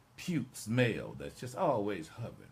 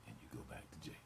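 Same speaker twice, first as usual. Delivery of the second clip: ordinary talk, nothing marked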